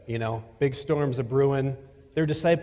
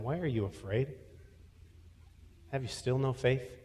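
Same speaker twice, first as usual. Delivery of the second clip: gentle rebuke; 8 dB quieter than usual. Acted. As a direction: chide them tenderly, not harshly. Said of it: "he says to them".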